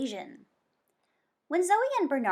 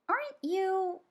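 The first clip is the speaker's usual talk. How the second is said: put-on higher kid girl voice; Zoe's voice; 10 semitones higher than usual. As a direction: voice young Zoe, curious. Zoe voiced in story.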